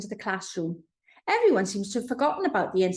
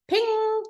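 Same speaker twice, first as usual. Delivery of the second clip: high bright ping sound; a sharp high ping like a notification; 13 semitones higher, 3 dB louder than usual. A narrator's reading. Sound effect in her head.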